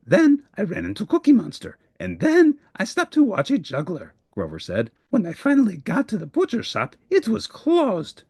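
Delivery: a monster listing his excuses; flustered Grover. Acted. Grover explains his day.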